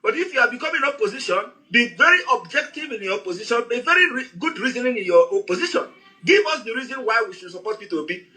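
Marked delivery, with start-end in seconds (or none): none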